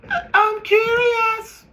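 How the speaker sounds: squeaky high-pitched slow "I'm curious"; drawn-out falsetto mockery of nosy people. Mocking nosy people in a high squeaky voice.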